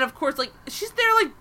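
ordinary talk

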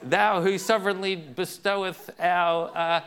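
mock solemn kids. Kids addressing their dad in mock-solemn old English, hammed up. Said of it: "if they talk like that, it would be silly".

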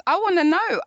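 ordinary delivery

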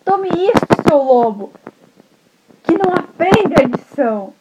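high, loud telling-off; angry grandma. Acted. Grandma jumping out to clobber the wolf, loud and angry. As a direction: strike and scold at once. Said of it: "e falou isso".

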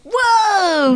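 slow amazed whoa; mock amazed. Imagined fan reaction.

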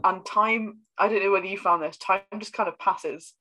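ordinary talk

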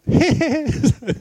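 high chuckling laugh in the king's voice; King Olav's laugh. Retold or imitated. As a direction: laugh like the old king, lightly. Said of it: "på den spesielle måten som kong Olav lo på". (kong Olavs særegne latter, lys)

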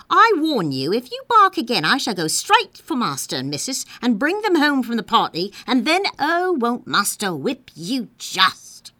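loud, high, threatening tirade; Liza, scolding. A narrator's reading. Liza warning Nana against barking, loud angry scolding. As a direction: warn the dog hotly.

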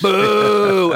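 loud, high rival-fan boo; mock booing. Rival fans booing each other across the bar, loud boo. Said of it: "be like".

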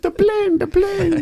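very high, laughing put-on accent; silly accent impression. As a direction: call it out like a goofy movie sidekick. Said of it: "The accents for me just all blend together".

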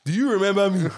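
high, loud Nigerian auntie voice; playful Nigerian relative accent. Retold or imitated. Voicing Nigerian relatives asking favours.

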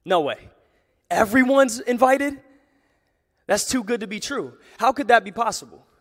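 high, loud incredulous reaction; incredulous, pitch jumping up on the questions; acted disbelief. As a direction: play the doubter who can't believe it. Voices a doubter hearing everyone's invited.

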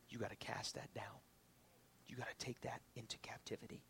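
whispered, intimate urging; hushed urging. Acted. Preacher quietly urging the congregation, whispered. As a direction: lean in and whisper the command.

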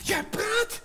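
put-on high, loud amazed call of a girl; surprised cry. Meis amazed that the mouse talks, high surprised cry. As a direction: gasp it out in disbelief. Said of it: "riep Meis verbaasd".

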